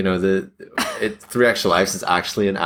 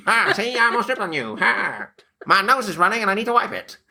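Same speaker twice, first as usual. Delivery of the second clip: cartoon gangster voice; 13 semitones higher than usual. Gangster voice again after nose wipe.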